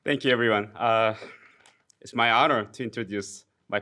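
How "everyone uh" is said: ordinary delivery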